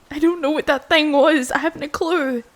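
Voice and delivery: loud, panicky rush; nearly shouting from shock; frantic loud voice. Acted. Shaken after the attack.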